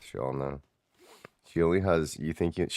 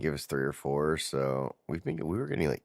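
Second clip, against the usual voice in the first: ordinary talk, nothing marked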